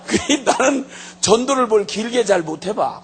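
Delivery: normal talking voice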